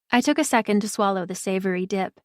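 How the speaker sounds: usual speaking voice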